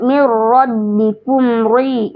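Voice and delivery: "mir rabbikum ri" recited slowly, with a long "mir"; slow tajwid demo. Shown as a demonstration. Teacher showing the right reading of the verse, slowly. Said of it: "tidak dibaca ri".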